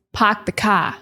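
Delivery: put-on "Park the car" in a Maine accent, R's dropped: pahk the cah. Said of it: "grandmother, who has a strong Maine accent".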